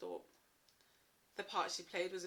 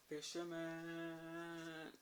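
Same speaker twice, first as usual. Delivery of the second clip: drawn-out title; 3 semitones lower, 3 dB quieter, much slower than usual. Real, not acted. Title drawn out after pause.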